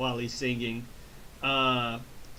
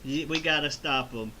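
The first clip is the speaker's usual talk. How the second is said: normal talking voice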